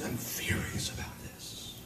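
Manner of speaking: seething whisper, no voice at all; furious under the breath. Retold or imitated. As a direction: whisper the fury through a tight throat. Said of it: "was just furious".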